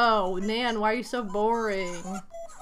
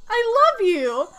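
gushing; 10 semitones higher, 6 dB louder than usual. Pretend. Gushing at a villager.

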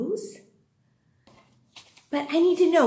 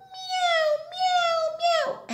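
very high kitty meows; cat meowing imitation; slower than usual. Teacher showing children what a cat says, high meows. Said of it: "and a cat goes".

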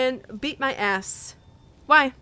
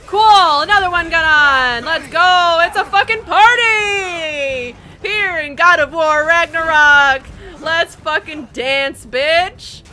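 loud mocking fury; sarcastic shout; 4 semitones higher, 10 dB louder, slower than usual. Real, not acted. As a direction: bellow it with bitter sarcasm. Enemy shields again, sarcastic rage.

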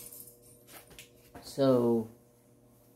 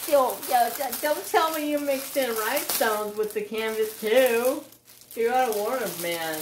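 high, loud, excited disbelief; worked-up, high-pitched astonishment; amazed protest; 7 semitones higher, 4 dB louder than usual. Real, not acted. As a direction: exclaim it high, stumbling over the start. Reacting to surprise rhinestones in canvas.

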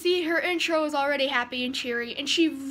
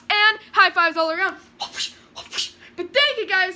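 loud, high, hyper outro; much louder, much higher outro; hyped outro; 9 semitones higher, 9 dB louder than usual. Acted. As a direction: end the show like a hyped YouTuber. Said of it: "Jacksepticeye outro".

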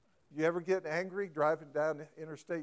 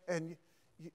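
normal talking voice

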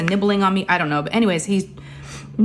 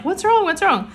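sharply high, worried what's wrong; alarmed question; 11 semitones higher than usual. Retold or imitated. Mother hearing her crying daughter, high alarmed calling. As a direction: call out in sudden alarm.

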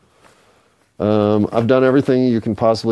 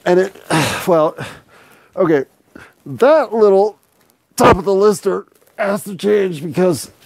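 high playful voice; 10 semitones higher than usual. Acted.

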